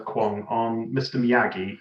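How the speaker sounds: usual speaking voice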